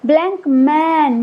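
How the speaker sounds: ordinary talk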